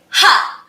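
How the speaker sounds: short, high, loud laughing "ha"; a single loud laugh syllable; bouncy "ha". Shown as a demonstration. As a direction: punch out one bouncy "ha". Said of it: "tell them to say".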